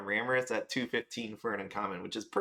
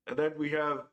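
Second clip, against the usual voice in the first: talking through a yawn; 4 semitones higher than usual. Real, not acted. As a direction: yawn while you say it. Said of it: "uh, yawned there".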